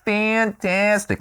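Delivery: Fantastic drawn out slow and high; mock exaggerated praise. Shown as a demonstration. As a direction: stretch Fantastic into a slow, hammy high rave. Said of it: "I'm making fun of myself".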